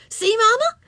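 put-on high, eager child calling Mama; excited cry. A narrator's reading. Ramona shows her mother the costume, excited high child cry. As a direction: show off in a high little-girl voice. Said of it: "she cried".

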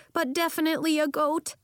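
plain reading voice

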